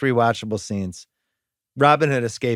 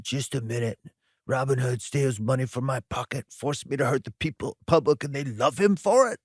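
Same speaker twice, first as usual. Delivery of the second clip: Rickman villain impression; 5 dB quieter than usual. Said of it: "Rickman on Fire when he does that".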